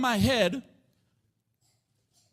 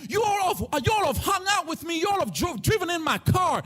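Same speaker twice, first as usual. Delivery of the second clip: raised, higher preaching voice for Jesus; Jesus challenging his friends, raised voice; 5 semitones higher than usual. Acted. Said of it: "He's saying".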